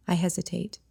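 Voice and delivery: ordinary delivery, nothing marked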